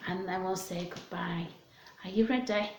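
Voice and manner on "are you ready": ordinary delivery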